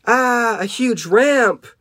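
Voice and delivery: high, drawn-out cry of alarm; high acted panic; alarmed scream. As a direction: wail it while hurtling toward danger. Character screams at huge ramp.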